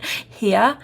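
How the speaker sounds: Boston "Here." said as "heah" with no R; Boston accent. Shown as a demonstration. Showing viewers her Boston accent, no R. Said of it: "We don't pronounce R's up north".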